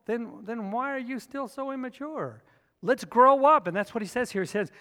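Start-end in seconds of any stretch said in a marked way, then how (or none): 2.8-3.7 loud, slow, emphatic preaching; big pulpit voice, drawn out; preaching at full force. Acted. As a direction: preach it out to the whole room.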